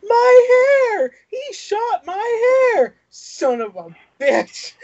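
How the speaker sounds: high, loud, slow wail over her hair; hammed-up outrage at a shot hairdo; mock shrieking princess. Princess wails after a guard shoots her hair, mock shriek. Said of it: "she's like".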